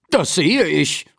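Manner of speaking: usual speaking voice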